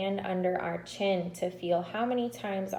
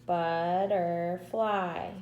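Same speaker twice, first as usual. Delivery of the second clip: Butterfly slowed into beats, but-ter-fly; syllables tapped out; much slower than usual. Shown as a demonstration. Teacher modelling syllable beats to the class, slowly. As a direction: say Butterfly slowly, one beat per syllable. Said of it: "How many syllables in butterfly".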